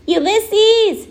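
high-pitched, drawn-out call; shouting a name. A narrator's reading. Flora searching the woods for her squirrel, shouting. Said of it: "shouted Flora".

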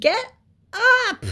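high, loud command; furious piglet yell; screaming. A narrator's reading. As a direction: scream each word separately. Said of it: "screamed Wilbur".

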